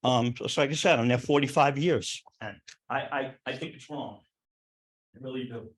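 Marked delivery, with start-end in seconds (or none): 2.4-5.7 fading out, struggling to hold it together; choked up. Real, not acted. Neighbour at town board hearing, near tears, voice falling away. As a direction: voice dropping almost to nothing, emotion catching. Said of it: "I'm sorry, guys, if I got emotional".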